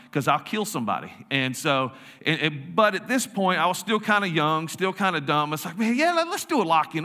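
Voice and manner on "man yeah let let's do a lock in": high-pitched eager younger self; naive enthusiasm. Retold or imitated. Young youth pastor agrees to a lock-in, naive enthusiasm. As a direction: play a young guy keen on a dumb idea.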